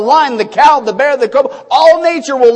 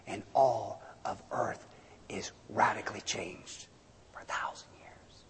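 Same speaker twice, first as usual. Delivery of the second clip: a whispered wonder, much softer; hushed whisper; 22 dB quieter than usual. Acted. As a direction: breathe it like a secret. Hushed awe over millennium.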